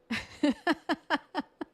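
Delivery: bursting out laughing, high and loud; amused laughter; real laugh. Real, not acted. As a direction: laugh helplessly at the joke. Laughing at Peter volunteering her.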